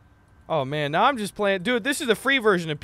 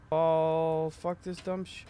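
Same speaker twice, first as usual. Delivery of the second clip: ordinary talk, nothing marked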